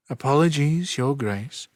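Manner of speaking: a touch of demure, lifted voice. A narrator's reading. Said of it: "said the witch demurely".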